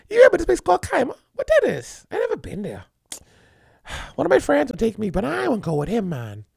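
high, quick sugary woman's voice fading to a hush; polite coy woman. Acted. Woman hinting to a dude about Kaima, sweet and coy. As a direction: coax him sweetly, then let it trail off. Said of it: "you very politely say to him".

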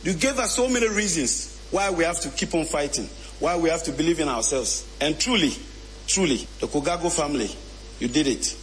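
ordinary talk

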